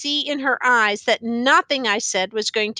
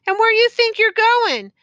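high, loud demand; sharp challenge; 11 semitones higher, 7 dB louder than usual. A narrator's reading. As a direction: confront him, pitched right up. Aunt challenging nephew leaving.

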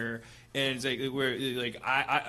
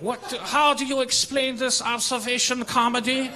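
heckler; 9 semitones higher, 5 dB louder than usual. Retold or imitated. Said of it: "they're like".

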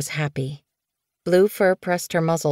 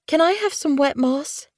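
higher, weak pleading queen; whimpering plea; 11 semitones higher than usual. Acted. Snowfur in labour asking for wet moss, weak and pleading. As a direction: plead weakly for water. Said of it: "Snowfur whimpered".

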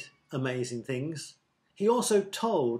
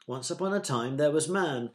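ordinary delivery, nothing marked

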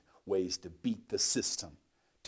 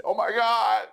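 shrill cry of pain, higher and louder; 10 dB louder than usual. Retold or imitated. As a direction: wail it out in agony. Crying out in pain at weights.

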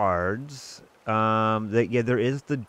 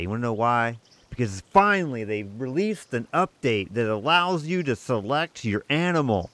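loud, super-excited channel intro; big, upbeat welcome with punched words; real excitement; 3 semitones higher, 4 dB louder than usual. Real, not acted. Gamer welcoming viewers to a new update, excited. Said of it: "I was pretty excited".

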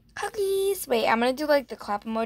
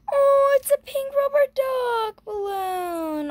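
much higher, slow squeal with a gasp; delighted squeal; 14 semitones higher, 3 dB louder, slower than usual. Real, not acted. Opening the pink balloon gift, squealing with delight. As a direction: gasp then squeal with joy.

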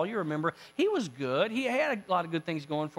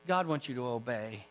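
soft, low and grave; solemn admonition; 6 semitones lower, 7 dB quieter than usual. Acted. Samuel telling Saul to obey God, hushed and solemn. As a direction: drop to a hushed, earnest reproof.

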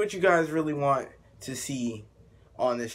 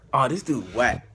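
ordinary talk

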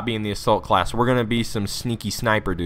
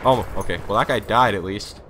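normal talking voice

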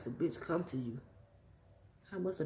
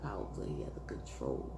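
drops to a whisper, trailing off; whispered musing to himself; 11 semitones lower, 7 dB quieter than usual. Real, not acted. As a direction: breathe the words out, barely voiced.

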